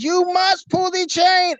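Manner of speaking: high-pitched, insistent German officer; General Burkhalter. Retold or imitated. German stranger overriding a refusal, high commanding German accent. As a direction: order it in a strained German accent. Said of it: "Is this General Bullculture talking to me".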